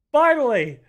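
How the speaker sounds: loud, high cry of relief; relieved shout. Real, not acted. Relief at the drop.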